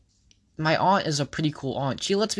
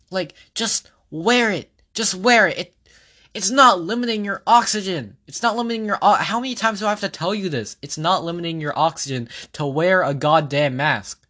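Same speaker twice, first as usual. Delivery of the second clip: exasperated scolding; 3 semitones higher than usual. Real, not acted. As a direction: scold them, fed up. Exasperated plea to wear masks.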